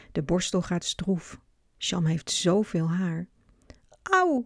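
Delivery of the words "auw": sharp high ouch; a high pained yelp from the child; cry of pain. A narrator's reading. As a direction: yelp it out, hurt. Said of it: "roept Sham".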